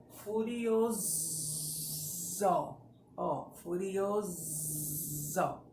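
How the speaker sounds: "furioso" said slowly, the s a long buzzing zzz; slow "furioso" with a bee buzz on the s; buzzing Z sound. Shown as a demonstration. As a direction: draw out the s in "furioso" as a voiced z. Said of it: "Não lembra a abelha?".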